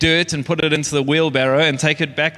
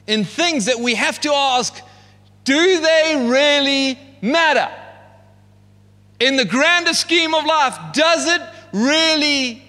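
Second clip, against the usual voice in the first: preaching at full force; 7 semitones higher, 3 dB louder than usual. Acted. Preaching climax on hevel.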